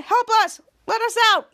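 high, loud calls to be freed; mock cry for help. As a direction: act out trapped pleading. Trapped men cry for help.

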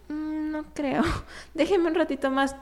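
a lightly voiced self in pain, chuckling; laughing reply. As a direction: brush off the coach, half laughing. Retelling her reply to the coach.

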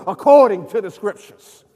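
loud, emphatic preacher's refrain; loud proclamation. Real, not acted. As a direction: proclaim it forcefully to the whole congregation. Refrain shouted, then repeated softly.

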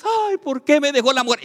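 high, loud mock whimper of a dumped man; mock lament. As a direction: whine like a forsaken husband. Mocks abandoned man's lament.

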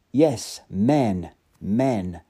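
plain reading voice

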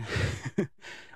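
a soft unvoiced laugh; breathy real chuckle. Real, not acted. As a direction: chuckle thinking of the funniest line. Laughing before funniest part.

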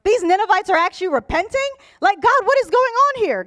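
imitating an outraged complainer, pitch climbing; incredulous protest. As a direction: demand an explanation in disbelief. Preacher voices Jonah protesting to God.

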